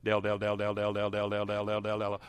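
low monotone drone of one name; mock repetitive prayer. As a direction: chant it mindlessly without a break. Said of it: "if I did that for twenty minutes".

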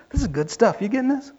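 usual speaking voice

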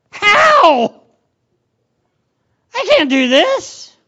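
high, loud outburst of disbelief; mock desperation. Disciples facing an impossible test, mock desperate outburst. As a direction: cry out in hammed-up helplessness.

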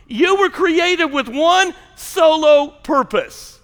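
high, loud, slow sermon delivery; impassioned preacher building to a shouted climax; full-force preaching. Acted. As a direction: drive each phrase home to the congregation.

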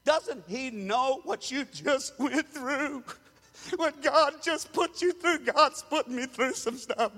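a mock self-pitying voice; whiny self-pity voice. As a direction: play the sorry-for-yourself believer. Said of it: "I'm stuck in this voice".

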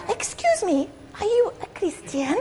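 put-on high, soft Mexican-accented lady; a light Mexican accent, high and hushed; sweet Mexican lady. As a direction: ask it gently as the little Mexican lady. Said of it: "I'm not very good at the Mexican".

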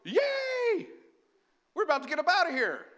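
high, loud, fast cheer of joy; excited cheer. Retold or imitated. Preacher acting his own joy at the rapture: excited high cheer. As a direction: burst out with a delighted yay and rush on. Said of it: "and I go".

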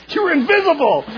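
loud, very high mock thrill; hammed-up excited shout; superhero-fan excitement. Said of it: "This is like my son, the superhero freak".

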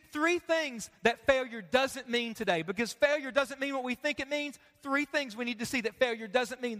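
ordinary talk

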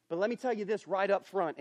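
ordinary talk

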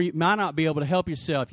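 ordinary talk

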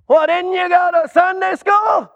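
a gatekeeper who can't believe it; exasperated gatekeeper. Acted. Gatekeeper questions a newcomer at heaven.

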